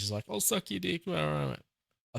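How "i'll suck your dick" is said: high-pitched mock plea from the knees; begging Homelander. Imitating Homelander on his knees, high mock begging. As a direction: put on a high, grovelling voice. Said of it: "where Homelander's on his knees".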